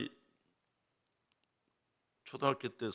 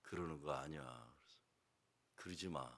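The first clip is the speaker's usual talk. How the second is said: gentle advice; 10 semitones lower, 15 dB quieter than usual. Retold or imitated. Pastor gently counsels father.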